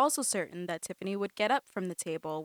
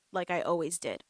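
usual speaking voice

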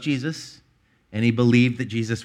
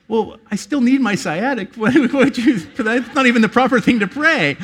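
laughing; 12 semitones higher, 7 dB louder than usual. Retold or imitated. Laughing retelling of own reply.

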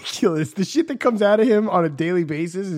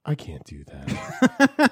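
lowered voice; 13 semitones lower, much slower than usual. Retold or imitated. Imitating [name] failing at inflection, lowered "I can't do that". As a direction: drop "I can't do that" right down, drawn out. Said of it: "he lowered himself and then came back up".

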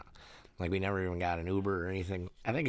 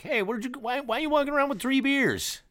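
much higher mock cop call; 4 dB louder than usual. Cop voiced in story.

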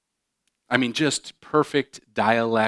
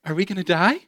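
high, bewildered, half-laughing; baffled tourist who can't follow; confused alarm; 8 semitones higher than usual. Retold or imitated. A tourist lost abroad voiced in bewildered alarm.

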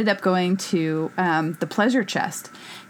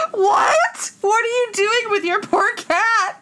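high-pitched mock horror; hammed-up horrified outcry; 10 semitones higher, slower than usual. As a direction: imitate an appalled house guest, pitched way up. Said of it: "she got this horrified look on her face".

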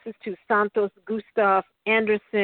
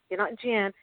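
ordinary talk, nothing marked

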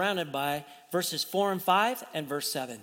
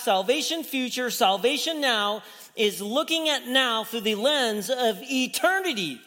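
rising, louder preacher's drive; preaching; 8 semitones higher, 7 dB louder than usual. Acted. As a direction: press the point home like a preacher. Preaching builds up.

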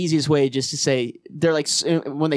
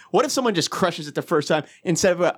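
normal talking voice

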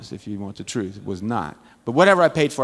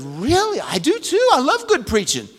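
delighted agreement; 10 semitones higher, 4 dB louder than usual. Retold or imitated. Delighted reply to the girl.

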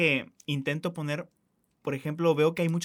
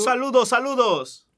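loud, slow, high cheer; shouted greeting; 8 semitones higher, 8 dB louder, slower than usual. Real, not acted. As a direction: call it out big to friends.

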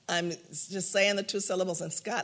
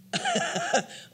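real high giggle; quick high laugh; bright burst of laughter. Real, not acted.

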